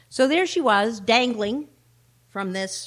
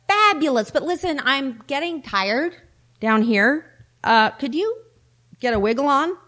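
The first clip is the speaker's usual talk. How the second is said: lightly voiced, higher sheep nagging upward; impatient sheep; 5 semitones higher, 3 dB louder than usual. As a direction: hurry God along as the tired sheep. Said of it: "said the sheep".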